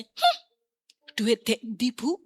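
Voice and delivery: "Hmm" tinggi melengking, lalu nada meremehkan; meniru teman mengejek. Retold or imitated. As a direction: bayangkan teman yang menyindir. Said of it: "paling dia akan berkata". (tiruan teman yang mengejek)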